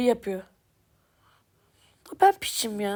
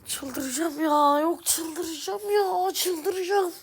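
slow, drawn-out, climbing higher; frustrated gamer stretching out "I'm going crazy", climbing higher; exasperated; 4 semitones higher, much slower than usual. Real, not acted. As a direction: drag it out, rising as you lose it. Losing his mind over the game.

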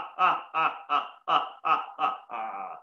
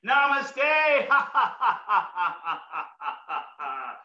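feel-good namaste laughter; slower than usual. Shown as a demonstration. One more round for the audience, laughing. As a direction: laugh it out one more time, then trail off. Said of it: "One more time for feel good".

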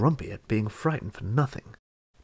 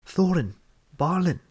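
calling names into the dark, higher and louder; crying out loud; 6 semitones higher, 3 dB louder than usual. A narrator's reading. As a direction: shout each name as far as you can. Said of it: "he cried as loud as he could".

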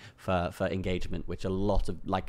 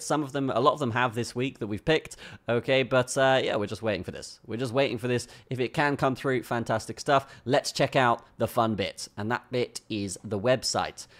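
ordinary talk, nothing marked

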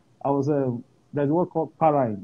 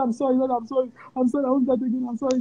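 mock pleading; 11 semitones higher, faster than usual. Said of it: "I said".

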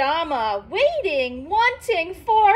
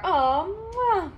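put-on soft kiss sound on baby's hair; tender kiss; 4 dB quieter than usual. Mama kissing baby's hair goodnight, soft kiss sound. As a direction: give a gentle smooch, softer. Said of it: "Mama kisses baby's hair".

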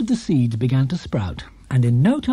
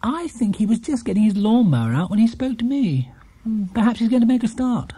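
higher, lightly voiced gentle lady; musing aloud as Mrs Brown; 7 semitones higher than usual. A narrator's reading. Mrs Brown telling the housekeeper about Mr Curry, light. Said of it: "said Missus Brown".

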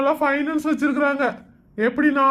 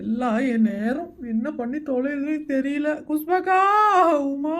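slow, tearful lament; crying; 3 dB quieter than usual. Acted. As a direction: wail softly over your rotten luck. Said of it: "நீ எதுக்கு இப்படி அழுகுற?".

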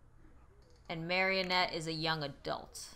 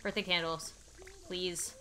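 usual speaking voice

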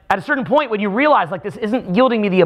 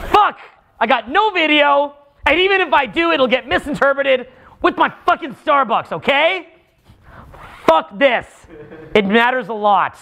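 higher-pitched mock fury over the ruined video; demo rant; 7 semitones higher than usual. Shown as a demonstration. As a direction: rant about the lost video in a raised, whining pitch. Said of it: "Okay, I'm getting mad".

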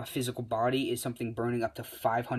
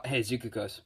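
ordinary delivery

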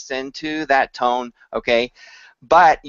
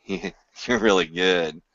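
sarcastic; 5 semitones lower, 4 dB quieter, slower than usual. Shown as a demonstration. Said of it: "they all have different meaning".

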